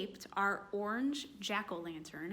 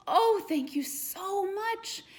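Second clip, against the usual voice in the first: grateful little ghost; 10 semitones higher, 6 dB louder, slower than usual. Acted. Grateful ghost thanks witch.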